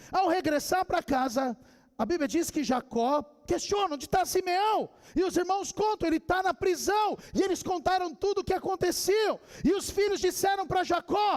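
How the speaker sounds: high, driving pulpit narration; impassioned preaching. Acted. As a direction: preach the story with mounting force. Preaches the story of Jacob.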